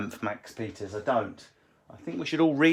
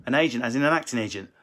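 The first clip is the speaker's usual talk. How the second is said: ordinary delivery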